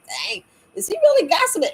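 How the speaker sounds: high, disbelieving dang with a lift; mock disbelief. Seeing men gossip on TV, high mock disbelief. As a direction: act amazed that a man gossips.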